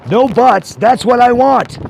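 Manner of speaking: loud, slow, insistent; angry demand. Real, not acted. Angry customer demanding from the bank agent, loud. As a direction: lay down the law to the agent.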